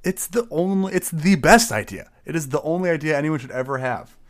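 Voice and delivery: loud, high, over-the-top praise; mock passionate. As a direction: champion the idea with fake fervor.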